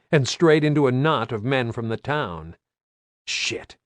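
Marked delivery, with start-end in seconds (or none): 3.3-3.8 voiceless breathy curse; whispered curse. Acted. Private thought, whispered.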